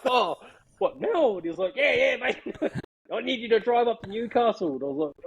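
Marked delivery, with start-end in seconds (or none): 0.0-1.3 high-pitched retold disbelief; astonished. Player invited to meet the Warriors, astonished. 1.8-4.7 high, upbeat imitation of the boss; eager invitation. Club boss invites him up to Newcastle, eagerly.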